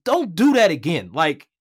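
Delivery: put-on Michael Eaves warning the young broadcaster, firm; stern mentor warning. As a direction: deliver it like a no-nonsense boss. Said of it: "he's like".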